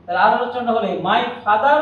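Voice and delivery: ordinary talk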